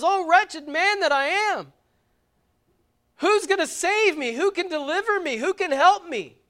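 high, loud, pleading cry of Paul; Paul's anguished cry. Acted. Preacher voicing Paul crying out for a saviour, high and loud. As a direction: cry out as a desperate man.

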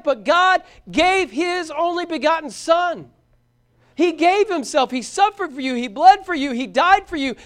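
loud, ringing sermon delivery; full-force preaching. Real, not acted. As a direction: proclaim it with mounting urgency.

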